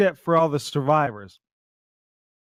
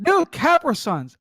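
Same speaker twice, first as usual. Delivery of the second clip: high, slow mock insistence; hammed-up stubborn friend; imitating Flint; 12 semitones higher, 3 dB louder than usual. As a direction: drag out Capra Suns up high.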